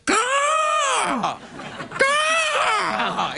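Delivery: raven caw. Retold or imitated. Raven caw to the audience, high and shrill. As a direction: squawk it out like a big crow. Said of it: "You know a raven call, Jeff?".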